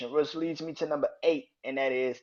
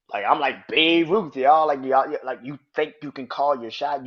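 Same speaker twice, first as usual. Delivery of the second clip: mocking shout; 2 semitones higher, 8 dB louder than usual. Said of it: "is like".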